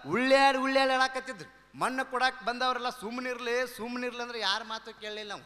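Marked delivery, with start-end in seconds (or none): 0.1-1.8 loud hammed-up wail, rolling in grief; husband mourning his wife in a loud mock wail. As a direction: wail like a widower at a funeral. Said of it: "ಹೆಂಡ್ತಿ ಸತ್ತಾಗ ಅವ ಇಷ್ಟು ಅಳ್ತಿದ್ದಂದ್ರ".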